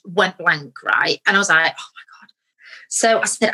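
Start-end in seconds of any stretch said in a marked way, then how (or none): 1.8-2.3 barely audible whisper; whispered panic. Retold or imitated. Actor whose mind went blank at audition, panicked whisper. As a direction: whisper it to yourself in dread.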